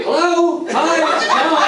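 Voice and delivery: high-pitched, eager phone greeting; mock an over-alert early riser picking up; John answering the phone. Rival answers the early call right away, high and chipper.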